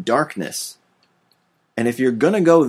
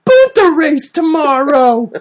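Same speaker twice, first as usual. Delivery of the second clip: put-on high, loud, slow kid voice; squeaky, drawn-out child impression; Anakin Skywalker voice; 8 dB louder, slower than usual. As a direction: shout it like an over-excited little boy. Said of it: "That's my Anakin Skywalker voice".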